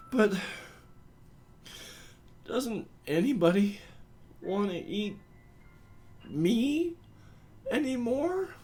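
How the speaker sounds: very high, small, halting plea; hurt, pleading. Acted. Forlorn human asking cryptid friends if they're still wanted, pleading. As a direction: squeeze it out word by word, long pauses, rising high at the end.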